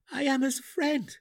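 high, loud, angry insistence; angry growl. A narrator's reading. Drizzt grabs the gnome's shoulder, angry growl. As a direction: throw it back angrily, pitch far up. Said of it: "Drizzt growled".

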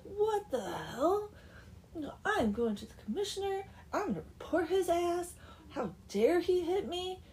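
high, loud, indignant outburst; furious. Acted. Doodad rants after being hit, furious. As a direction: act outraged, pitch leaping up. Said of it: "the doodad is furious".

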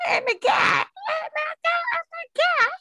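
high, loud, half-laughing oh my God; star-struck squeal. Retold or imitated. Teller relives meeting the rock star, squealing. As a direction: squeal like meeting your idol.

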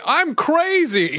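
put-on old crazy man. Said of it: "old enough to say".

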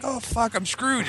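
high-pitched mock panic; dreading kid. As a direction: fret like a doomed little kid. Kid dreading another beating.

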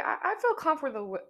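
ordinary delivery

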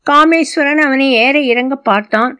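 ordinary delivery, nothing marked

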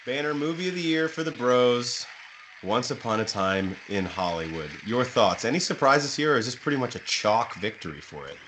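ordinary delivery

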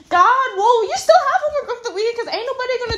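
high, fast, laughing exasperation; indignant, half-laughing complaint; exasperated outburst. Acted. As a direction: rush it out, pitched high in disbelief. Mocking teacher's homework with exasperation.

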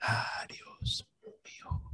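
breathy seductive whisper; sultry whisper. Acted.

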